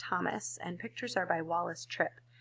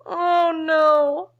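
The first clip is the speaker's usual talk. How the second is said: loud, higher buzzing "oh no"; buzzing bees; 8 semitones higher, 14 dB louder than usual. Acted. The bees buzz back in alarm, loud. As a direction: buzz it like a bee. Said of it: "buzzed the bees".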